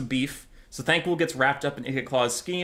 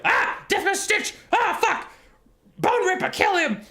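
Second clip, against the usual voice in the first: much higher squealing rat voice, a panicked shout; panicked rat sorcerer. Retold or imitated. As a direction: shriek like a cornered rat warlock calling for help. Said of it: "Thanquol's like".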